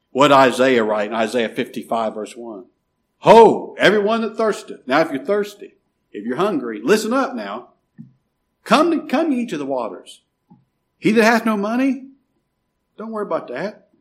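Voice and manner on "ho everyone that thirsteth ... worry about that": fiery preaching. Acted. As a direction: cry the invitation out to the crowd. Reads Isaiah at full force.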